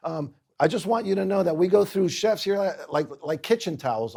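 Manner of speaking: hammed-up New Jersey chef sizing up the new guy; New Jersey accent. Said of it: "You know, guy from New Jersey".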